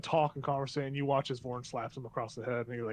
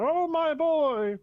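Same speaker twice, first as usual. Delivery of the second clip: doting alien father; 12 semitones higher, 6 dB louder, much slower than usual. Acted. Dredovex doting on his baby.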